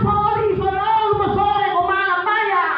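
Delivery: high, loud put-on voice answering back; mock Sabu reply. The Sabu fish seller throws the words back, high and loud. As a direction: squeak it back at him, mocking.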